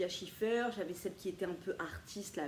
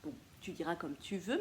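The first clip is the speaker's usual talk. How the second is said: ordinary delivery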